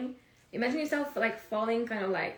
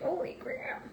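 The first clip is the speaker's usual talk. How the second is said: whispered amazement; 8 semitones higher, 6 dB quieter, slower than usual. Retold or imitated. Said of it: "I'm like".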